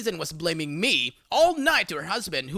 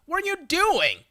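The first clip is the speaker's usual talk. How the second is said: much higher mock yell from a porch; husband yelling from the porch at his wife, higher; retold yelling out; 11 semitones higher than usual. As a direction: call out to his wife across the yard. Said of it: "steps out on his porch and yells out".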